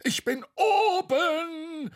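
very high, drawn-out triumphant cry; triumphant call. A narrator's reading. Bo reaching the top of Tete, triumphant squeal. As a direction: crow it out slowly from up high. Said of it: "rief Bo".

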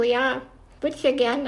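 ordinary delivery

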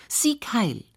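high-pitched mock shout of a child; lightly acted little girl's chant; child's shouting. A narrator's reading. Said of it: "schreit das Bertchen immer wieder ganz laut". (gespielter, hoher Kinderruf)

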